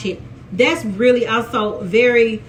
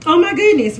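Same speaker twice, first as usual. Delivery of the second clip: excited; 5 semitones higher, 5 dB louder than usual. Real, not acted. Shocked by the nude scene.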